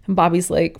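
ordinary talk, nothing marked

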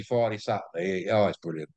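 ordinary talk

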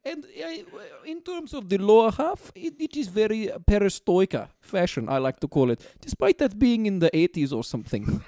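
lightly voiced Soviet dictator with a vague accent; Joseph Stalin. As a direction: play an accented dictator as fashion expert. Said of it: "Joseph Stalin here".

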